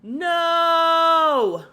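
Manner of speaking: long, high, full-voiced yell; real yelled no. Real, not acted. Mom reacting to her daughter going out, yelling in protest. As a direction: burst out with a drawn-out cry of protest. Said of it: "sorry, I just yelled out".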